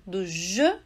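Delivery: usual speaking voice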